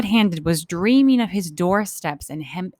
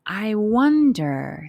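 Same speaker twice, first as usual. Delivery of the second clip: usual speaking voice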